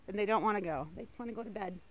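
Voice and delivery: usual speaking voice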